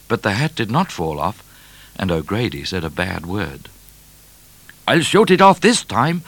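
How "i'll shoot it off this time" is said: high, loud, vexed shout; heated trooper's threat; swearing he will. A narrator's reading. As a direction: vow it loudly in frustration. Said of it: "swore O'Grady".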